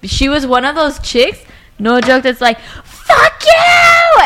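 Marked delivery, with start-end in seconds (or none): 2.8-4.2 shrieked, drawn-out cursing, high and loud; enraged scream. Retold or imitated. Girlfriend in a crazy fight with her boyfriend, screaming.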